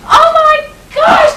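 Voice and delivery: high, loud, drawn-out squeal; squealing oh my gosh. Acted. Girl thrilled by the poem, slow high squeal. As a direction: squeal with delight.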